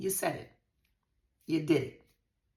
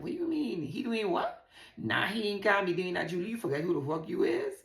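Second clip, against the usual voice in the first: high-pitched, louder disbelief; indignant, raised-voice mock challenge; incredulous friends; 4 semitones higher, 4 dB louder than usual. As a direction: act out friends calling someone out. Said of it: "people will be like".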